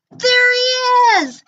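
high, slow joyful shout; excited shout. Acted. The siblings spotting Nezlex, shouting high and loud. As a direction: yell it with relief. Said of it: "cried Bork and Gork".

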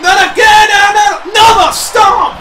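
loud, high-pitched, disbelieving outcry; shocked shouting. Real, not acted. Commentator seeing another stomp land, shouting in shock. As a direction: yell in shock at the repeat move.

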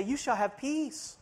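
soft, slow mock breathy hush; mock breathy whisper. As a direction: hush it like a falsely holy preacher. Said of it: "they're gonna whisper and get all breathy".

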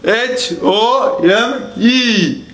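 letters spelled out slowly, one by one; slow spelling out. Acted. Teacher spells the word to students, slowly. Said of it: "So first word".

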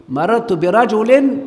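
high, loud and slow; loud slow classroom example; emphatic example. Shown as a demonstration. Teacher proclaims example sentence.

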